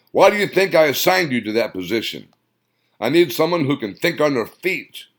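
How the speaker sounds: loud, clipped commanding bark. Acted. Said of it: "He barked into the phone".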